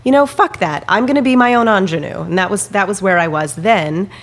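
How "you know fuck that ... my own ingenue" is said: defiant. Real, not acted. Defiant outburst about rejection.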